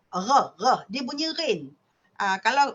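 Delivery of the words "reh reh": "Reh, reh" with a strong uvular r at the back of the throat. Shown as a demonstration. Lecturer showing students the strong Kedah r. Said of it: "Kuat sangat".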